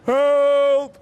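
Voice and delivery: very loud, high held cry; scared roar for help. A narrator's reading. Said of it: "he roared".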